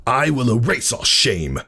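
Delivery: proud brother vowing to purge shame; voiced stallion, righteous; Thunderhead's voice. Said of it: "Thunderhead said".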